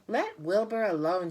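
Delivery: high, loud, sharp rebuke; sharp scolding. A narrator's reading. Charlotte snapping at the lamb to leave Wilbur alone, sharp and loud. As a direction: snap at the bully to leave him be. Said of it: "she spoke sharply to the lamb".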